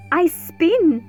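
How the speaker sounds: ordinary delivery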